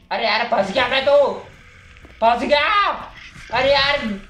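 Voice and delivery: alarmed cry. Real, not acted. Stuck in bathroom during game.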